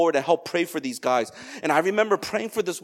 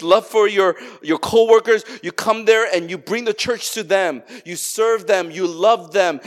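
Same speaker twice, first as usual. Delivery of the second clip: raised, ringing pulpit delivery; fervent preaching; 3 semitones higher, 4 dB louder than usual. Acted. Pastor rallying the congregation, full preaching voice. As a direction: preach it out to the whole room.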